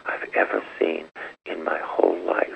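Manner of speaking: hushed teary whisper, remembering the tears; barely voiced, choked with emotion; a retold tearful whisper; retold weeping. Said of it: "I'm just weeping".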